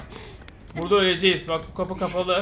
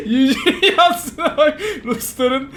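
laughing while talking; 8 semitones higher, 5 dB louder, much slower than usual. Real, not acted. Reading comment while laughing.

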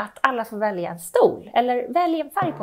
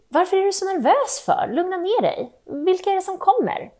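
put-on higher child voice, teasing the nervous mom; cheeky child asking questions; curious kid; 8 semitones higher than usual. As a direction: kid poking at mom's nerves.

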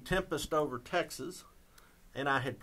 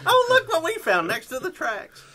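put-on much higher, louder silly voice of someone finding a baby; mock finder's voice; 9 dB louder than usual. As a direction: squeal it like a delighted stranger spotting a foundling. Baby-train joke, finders' voice.

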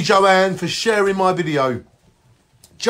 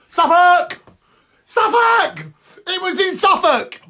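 shouting Suffolk; 12 semitones higher, slower than usual. Acted. Live stream host hollering the county name. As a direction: bellow the place name up high.

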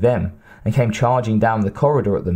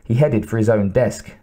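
ordinary delivery, nothing marked

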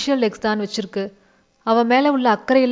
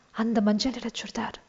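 hushed voice; 9 dB quieter than usual. A narrator's reading. Pinchi quietly pointing out the girl to Udaya, hushed. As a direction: point her out quietly so the group won't hear. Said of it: "பின்சி சன்னமான குரலில் சொன்னாள்".

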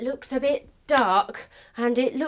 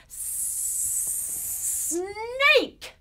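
long hissed S before the word; snake hiss; 10 semitones higher than usual. A narrator's reading. Drawn-out reveal of snake.

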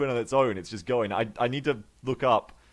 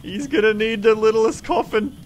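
mock grief; 13 semitones higher, 5 dB louder than usual. Mock mourning dead soldier.

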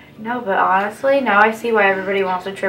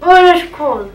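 surprised; 9 semitones higher, 7 dB louder, slower than usual. Real, not acted. Cold primer on skin.

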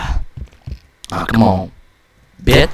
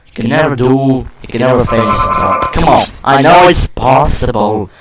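ordinary delivery

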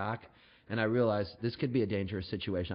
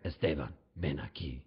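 mock tough cop; 7 semitones lower than usual. Federale calls him back.

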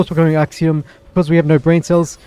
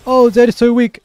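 mocking whiner; 6 semitones higher, 3 dB louder than usual. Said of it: "You guys always crying, complaining".

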